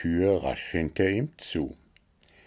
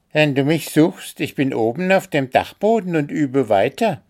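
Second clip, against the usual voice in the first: high, loud, quick announcement; self-important; 7 semitones higher, 5 dB louder than usual. A narrator's reading. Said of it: "teilte Spooky wichtig mit". (wichtigtuerisch, hoch und schnell)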